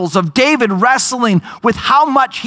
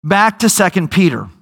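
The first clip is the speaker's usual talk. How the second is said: ordinary delivery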